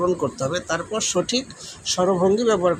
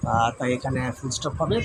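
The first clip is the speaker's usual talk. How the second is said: usual speaking voice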